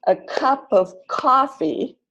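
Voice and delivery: a CUP of COFfee, weak words as schwa; stressed syllables longer. Shown as a demonstration. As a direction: slowly lean on cup and coffee. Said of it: "notice that the stressed".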